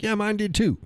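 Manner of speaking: high-pitched light imitation of the missus; mimicking the wife. Husband mimics his wife agreeing, in a high voice. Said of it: "And she's like".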